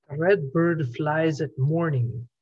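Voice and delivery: low, slow stranger's voice; deep male voice. A narrator's reading. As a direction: mumble it low and cryptic. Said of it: "a strange man's deep voice".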